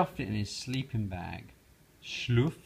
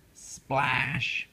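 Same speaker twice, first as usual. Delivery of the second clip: usual speaking voice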